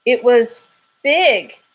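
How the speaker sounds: high, loud squirrel voice, dragging out scary; a jittery squirrel spooked to the bone; scared squirrel. Acted. Squirrel telling the detective about the monster, high and loud.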